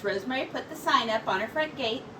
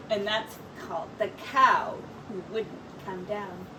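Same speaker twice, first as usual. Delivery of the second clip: usual speaking voice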